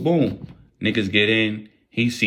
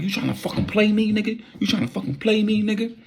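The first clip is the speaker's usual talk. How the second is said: high mock furious demand; hammed-up angry threat; high-pitched mock rage; 11 semitones higher than usual. Angry husband.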